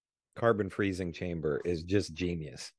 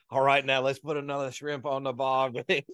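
hammed-up Australian accent, pitched up; mock Australian accent; 4 semitones higher than usual. Joking about a background extra, hammy Australian accent. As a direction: toss off a cartoon Australian line. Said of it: "That guy is probably not Australian".

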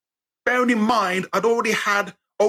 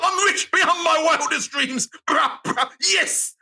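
much higher, slow, shouted joy; ecstatic shout; 11 semitones higher, slower than usual. Pretend. Lottery winner to family, ecstatic shout. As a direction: scream it like you just won. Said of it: "You'd be like".

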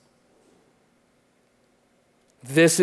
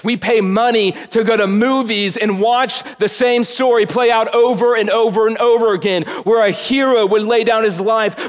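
louder, higher, driving sermon voice; full-force preaching; 9 semitones higher, 8 dB louder than usual. Acted. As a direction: preach it with rising fervor. Preaching climax.

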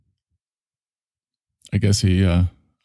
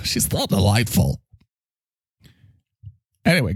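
usual speaking voice

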